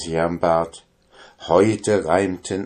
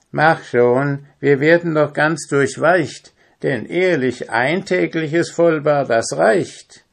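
higher, impatient grumble; grumbling; 6 semitones higher, 3 dB louder than usual. A narrator's reading. Willi is fed up with getting soaked, grumbling. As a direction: complain and hurry him along. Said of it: "maulte Willi".

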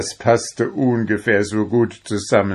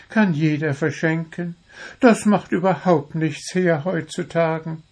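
high-pitched, know-it-all bird; philosophizing little bird; 8 semitones higher than usual. A narrator's reading. Little bird justifies stolen flowers to the dwarf, philosophizing. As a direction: lecture high and birdlike. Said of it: "philosophierte Zwitschi".

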